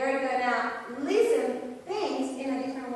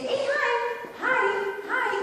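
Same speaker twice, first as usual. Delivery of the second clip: excited greeting; 11 semitones higher, 4 dB louder than usual. Acted. Voicing an overeager greeting to kid.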